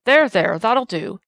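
loud, firm words to settle a brawl; firm calming. A narrator's reading. Constable separating two men on the cobbles, loud and firm. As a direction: cut through the noise to calm him down.

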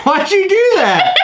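high laugh into a question; laughing, can't believe it; laughing, asking the cohost why he renamed it; laughing disbelief. Real, not acted. Surprised at the renamed podcast.

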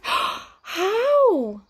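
high, loud astonished demand; gasped. A narrator's reading. Wizard asks how to regain his powers, astonished. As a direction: burst out eagerly, breath caught. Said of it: "gasped Flabbergast".

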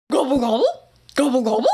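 turkey gobble engine. Shown as a demonstration. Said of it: "the sound the tractor makes".

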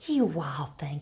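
whispered teasing to a pet; affectionate whisper. Real, not acted. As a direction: breathe it fondly at the animal.

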